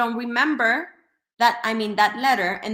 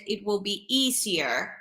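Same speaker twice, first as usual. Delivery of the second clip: ordinary talk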